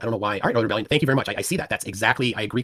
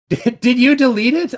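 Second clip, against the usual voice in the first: high, louder mock panic with a laugh; mock alarm; 13 semitones higher, 6 dB louder, slower than usual. Real, not acted. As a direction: pretend to panic that the post is gone. Streamer can't find the post.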